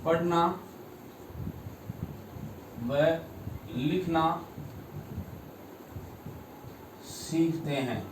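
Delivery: word by word with long pauses; dictation pace. Shown as a demonstration. Teacher giving students the definition to copy, slow dictation.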